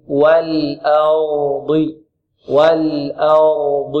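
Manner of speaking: very slow "والأرض", light hamza, heavy ر and ض; slow tajweed drill. Shown as a demonstration. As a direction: build up "والأرض" piece by piece, drawn out. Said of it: "ونفخم ماذا؟ الضاد، هكذا".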